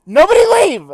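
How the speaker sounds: loud, high-pitched cry to the group; mock panicked shout. Real, not acted. Reader stops friends going after a joke, loud high yell. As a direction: yell it out high, in joking desperation.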